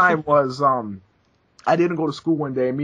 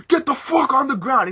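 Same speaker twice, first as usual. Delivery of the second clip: high mock cop yell; retold cop shouting at kids; 10 semitones higher than usual. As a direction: bark it like an angry cop. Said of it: "and he's like".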